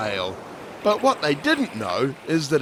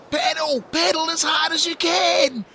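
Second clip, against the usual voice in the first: shouting. A narrator's reading. Tia urging her friends to paddle, high rallying shout. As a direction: urge them on at full voice. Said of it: "shouted Tia".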